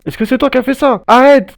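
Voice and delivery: high shouted demand; yelling in alarm. Real, not acted. Confronting the killer.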